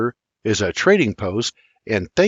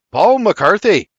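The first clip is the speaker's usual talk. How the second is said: hearty introduction; 9 semitones higher, 7 dB louder than usual. Acted. Stranger introduces himself heartily.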